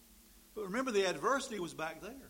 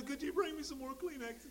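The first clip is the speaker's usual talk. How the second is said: high, whiny voice of a sniffling diner; mock weepy diner; 9 semitones higher, 3 dB quieter than usual. Diner without his steak asking for Kleenex, high weepy whine. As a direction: beg for tissues like a spoiled crybaby.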